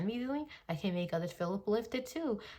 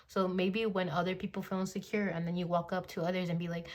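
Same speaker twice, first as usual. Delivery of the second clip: ordinary delivery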